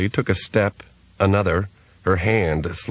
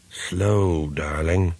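put-on slurred old man; much slower than usual. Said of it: "slurred voice".

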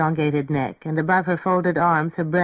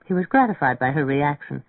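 usual speaking voice

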